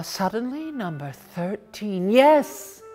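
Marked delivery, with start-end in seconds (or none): none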